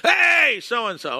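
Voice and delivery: a plain call across the room; normal holler. Shown as a demonstration. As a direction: hail someone at the back of the room. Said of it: "a normal holler".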